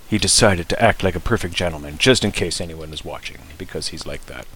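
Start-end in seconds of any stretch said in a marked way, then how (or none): none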